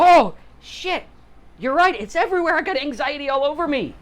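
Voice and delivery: high, loud, hammed-up panicked yelp; mock panic. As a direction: ham up a sudden freak-out over the diagnosis. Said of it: "And I go".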